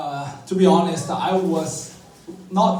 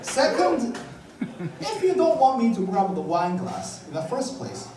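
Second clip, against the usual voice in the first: high, incredulous pleading; indignant protest; 8 semitones higher than usual. Retold or imitated. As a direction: squawk it back in disbelief.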